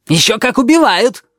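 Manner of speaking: high, loud angry retort; shouting match. Acted. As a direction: shout him down. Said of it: "Они орали друг на друга".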